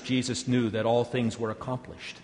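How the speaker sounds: ordinary delivery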